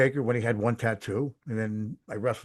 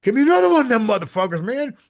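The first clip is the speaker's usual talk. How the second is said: loud, high, fast wrestler shout; Road Warrior yell; 11 semitones higher, 7 dB louder than usual. Retold or imitated. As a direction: roar it like a hyped-up wrestler. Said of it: "loudest Road Warrior voice".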